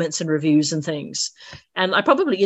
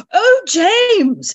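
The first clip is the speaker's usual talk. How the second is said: crisp upper-class English voice; RP accent; 13 semitones higher, 6 dB louder than usual. Retold or imitated. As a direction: put on your best posh BBC English. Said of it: "in my very best R P voice".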